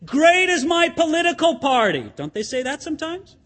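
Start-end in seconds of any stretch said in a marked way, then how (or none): 0.0-2.1 higher, louder rallying cry; party supporter crying out at a rally; mock rally cry. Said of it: "Sometimes they'll say".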